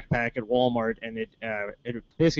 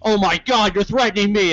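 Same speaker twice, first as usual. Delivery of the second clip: higher, louder imitated outrage; mock alarm; 6 semitones higher, 5 dB louder than usual. Store woman accusing the tall customer, mock alarm. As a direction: exaggerate the woman's alarm at being threatened. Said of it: "the woman's like".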